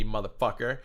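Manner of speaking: ordinary talk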